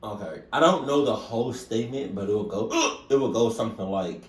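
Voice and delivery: ordinary talk, nothing marked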